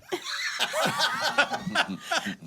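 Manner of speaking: high laughing burst; burst of laughter. Real, not acted. Cracks up at Tim's reply.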